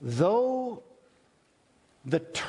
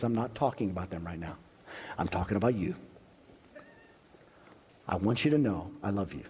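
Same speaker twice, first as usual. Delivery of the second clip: lower, softer and close, like a hand on your face; tender God voice; 6 semitones lower, 3 dB quieter, much faster than usual. Retold or imitated. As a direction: speak gently and intimately to one person. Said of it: "cups your hand in his face and says".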